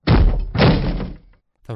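loud, very slow, emphatic; dramatic boom. A narrator's reading. Dramatic narration impact.

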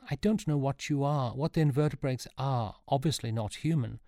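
mildly imploring; begging. A narrator's reading. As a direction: implore while groping for understanding. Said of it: "begged Sean".

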